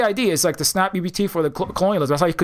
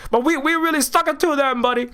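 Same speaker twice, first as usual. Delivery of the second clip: much higher, gloating mock voice; squeaky high sarcastic brag; mock smug pride; 9 semitones higher than usual. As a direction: crow it like a fool who thinks he won. Mocking Europeans' smug pride.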